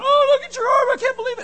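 hammed-up high, loud shriek of panic; freaking out. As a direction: mimic a hysterical bystander. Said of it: "someone's freaking out, standing going".

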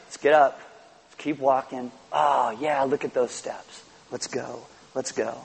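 soft, fading encouragement; Jesus helping a fallen believer up, tender; gentle encouragement. Pretend. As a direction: coax a child gently onward. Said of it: "Or do you think He's like".

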